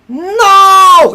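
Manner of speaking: sharp, loud NO; a burst of frustration; loud emphatic no. Real, not acted. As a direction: throw down a loud no. Angry rebuttal.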